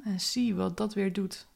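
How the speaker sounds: guided meditation. Acted. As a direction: guide the listener gently inward.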